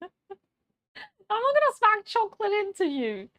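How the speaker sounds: high, loud, slow laughing reply. Real, not acted.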